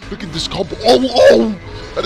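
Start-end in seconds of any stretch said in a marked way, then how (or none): 0.8-1.6 excited yelp. Real, not acted. Excited reaction to combo.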